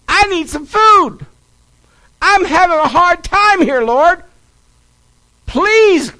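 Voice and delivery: high, loud pleading to heaven; acted-out elderly lady crying out her prayer; shouted prayer. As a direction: call out to God for help, begging. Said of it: "and shouted".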